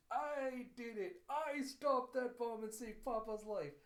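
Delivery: high, loud, slow boasting, building to the last words; triumphant child. Retold or imitated. Child gloats over saving papa's life, loud triumph. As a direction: crow it out like a little hero.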